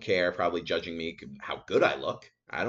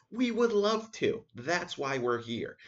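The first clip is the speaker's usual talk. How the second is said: eager clerk; 9 semitones higher, slower than usual. Pretend. Imagined eager lumber staff.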